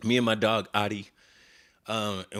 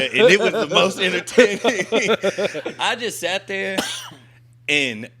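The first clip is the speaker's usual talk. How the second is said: excited, slow and laughing through the words; 6 semitones higher, 5 dB louder, slower than usual. Real, not acted. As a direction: gush about it while cracking up. Recalling the Irish bar, amused.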